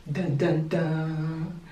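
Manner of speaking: low, hushed "dun dun dun"; mock suspense sting. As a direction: drop it like a reveal sound effect. Mimics a dramatic reveal sting.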